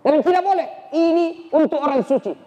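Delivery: high, firm, protective; firm refusal. Acted. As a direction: hold the scripture back from him. Sister refuses to show scripture.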